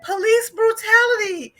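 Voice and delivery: high, wailing cry of injustice; mock wail. Woman on the floor wails at the cop in mock anguish. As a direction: wail it like a wronged victim.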